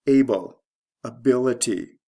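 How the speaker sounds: ABle, then aBILity; shifting stress. Shown as a demonstration. Teacher reading a noun pair across, stress shifting to second syllable. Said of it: "The stress shift is from the first to second syllable".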